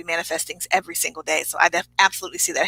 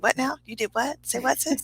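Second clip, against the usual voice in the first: Tabitha Brown; 7 semitones higher, 7 dB quieter than usual. Retold or imitated. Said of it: "a live that Tabitha Brown did".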